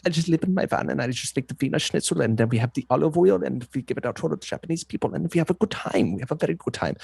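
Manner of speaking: mock German accent, lightly done; a hammed-up German hot dog guy living in his van. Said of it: "I'm working on my German accent".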